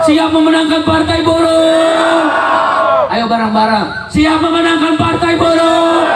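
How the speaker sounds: slow, loud call-and-response chant; rally speech. Acted. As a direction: rouse the crowd, stretching each word.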